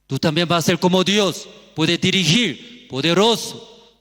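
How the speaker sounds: loud, high-pitched serpent's promise; urgent tempting. Acted. Preacher voicing serpent entering the woman's heart, urgent tempting. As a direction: press the temptation forcefully.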